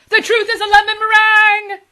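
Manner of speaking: very loud, high bellow; yelling at top of lungs. Acted. Husband shouting to wake his wife, full yell. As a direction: bellow it to wake the whole house. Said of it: "he yelled at the top of his lungs".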